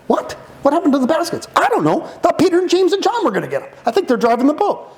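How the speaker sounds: high, rushed disciple chatter; flustered disciple. Acted. Disciple asking about the baskets, high and rushed. Acted disciples' conversation in boat.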